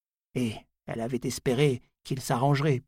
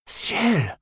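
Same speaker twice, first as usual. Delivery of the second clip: higher, louder cry of shock; startled exclamation; 6 semitones higher, 4 dB louder than usual. A narrator's reading. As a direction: cry it out in sudden alarm. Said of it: "s'écria-t-il".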